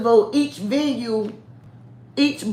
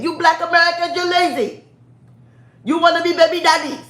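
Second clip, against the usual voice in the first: high, loud mock Nigerian accent; Pookie; 7 semitones higher, 3 dB louder than usual. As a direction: scold in an imitated Nigerian accent. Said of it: "That's what the Nigerian podcast Pookie said".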